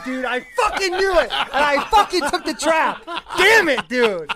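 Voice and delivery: laughing. Real, not acted. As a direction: burst out laughing, caught out. Caught by a setup, laughing.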